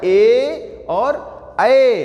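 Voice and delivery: ए and ऐ drawn out very slowly; very slow vowel demo. Shown as a demonstration. Teacher shows how ए and ऐ are pronounced, very slowly. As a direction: stretch ए, then ऐ. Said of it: "कैसे हो रहा है?".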